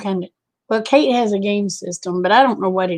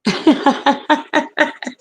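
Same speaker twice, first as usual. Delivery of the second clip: amused laugh; 6 semitones higher than usual. Real, not acted. Laughing while reading chat.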